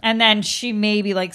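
ordinary talk

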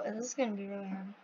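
low, very soft, quick aside; daunted murmur. Real, not acted. Player facing a really hard tower, daunted murmur. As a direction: mumble it to yourself, sinking in pitch.

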